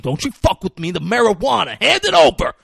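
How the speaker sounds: building to a high, loud demand; mock threat. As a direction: squeeze the kid for the weed, rising to a yell. Denzel threatens kid.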